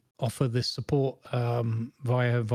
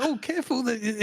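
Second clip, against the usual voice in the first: neighbour; 10 semitones higher than usual. Retold or imitated. Neighbour's warning voiced.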